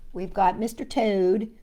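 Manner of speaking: usual speaking voice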